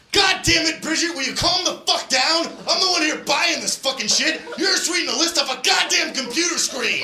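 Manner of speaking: high, yelled rant at someone on the phone; shouting down the phone at his wife; furious shouting. Retold or imitated. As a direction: blow up at Bridget. Angry shopper yelling at Bridget.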